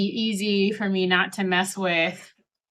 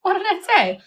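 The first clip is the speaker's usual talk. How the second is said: mock innocent; 10 semitones higher than usual. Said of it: "And I'm like".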